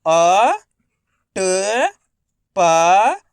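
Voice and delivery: अ, ट, प each sounded alone with pauses; letter by letter. Shown as a demonstration. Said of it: "चलिए देखिए". (अक्षर-अक्षर अलग करके)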